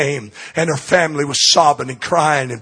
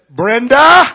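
higher, louder call through a door; 6 semitones higher, 6 dB louder than usual. Acted. Pastor calling to Brenda outside her room, raised call.

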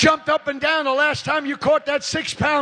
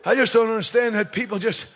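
ordinary talk, nothing marked

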